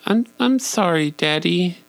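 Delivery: put-on a little boy's timid sorry; child's apology. Son apologizing to Daddy in a high child voice. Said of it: "And he said".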